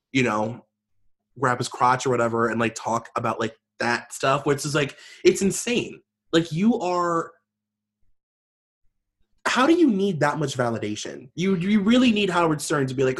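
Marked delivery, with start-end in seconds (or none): none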